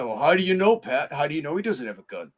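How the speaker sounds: hammed-up doubting bystander; mock skeptic. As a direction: needle Pat like a know-it-all critic. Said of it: "somebody might say".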